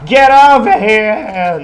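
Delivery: huge bellowed Get over here; game battle cry. Pretend. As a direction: bellow it like a fighter. Shouts it again.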